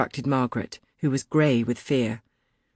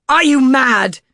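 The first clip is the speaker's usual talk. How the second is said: loud, high outraged scream; 10 semitones higher, 10 dB louder than usual. A narrator's reading. Crompton screaming at the narrator, outraged. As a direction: yell back in disbelief. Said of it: "he screamed".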